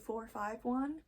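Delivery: "four five one" as three separate digits; "four five one", digit by digit, questioning. Shown as a demonstration. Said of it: "Do they say".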